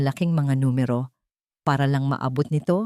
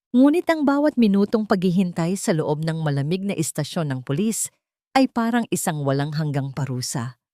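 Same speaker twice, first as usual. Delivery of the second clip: plain reading voice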